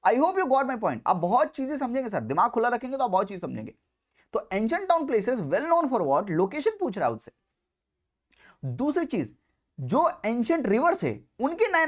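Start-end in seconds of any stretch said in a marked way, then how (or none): none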